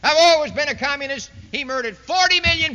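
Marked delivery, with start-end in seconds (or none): 0.0-1.3 loud, defiant proclamation; a loud mocking boast; mock proud declaration. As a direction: bellow it like a dictator unmasking himself. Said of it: "Mao Zedong rose up and said".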